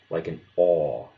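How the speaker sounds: "aw" as in English paw, one merged vowel; teacher demonstrating the merged vowel "aw"; aw sound demo. Shown as a demonstration. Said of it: "the result will be somewhere in the middle".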